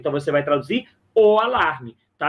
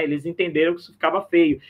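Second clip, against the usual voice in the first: usual speaking voice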